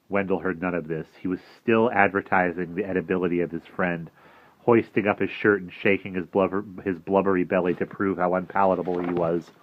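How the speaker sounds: plain reading voice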